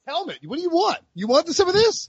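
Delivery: high, hammy fight challenge; mock tough guy. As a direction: playfully square up for a fight.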